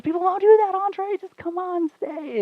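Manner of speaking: put-on very high squeaky girlfriend plea; mock pleading. Girlfriend begging boyfriend to stay in car, squeaky mock pleading.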